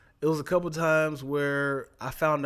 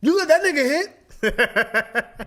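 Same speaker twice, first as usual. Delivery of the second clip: high, loud squealing protest; mock outrage; 12 semitones higher, 9 dB louder than usual. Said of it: "I was doing stuff like that".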